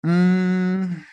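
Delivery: usual speaking voice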